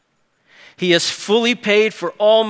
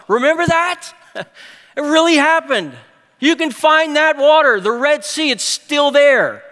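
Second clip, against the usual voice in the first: high, eager, delighted reminding; thrilled as if pointing at the Red Sea; voicing the psalmist's joyful memory, high and eager; excited psalmist; 6 semitones higher, 4 dB louder than usual.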